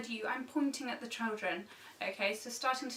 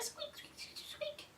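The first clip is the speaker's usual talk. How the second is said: mouse squeaks; 11 dB quieter, slower than usual. Shown as a demonstration.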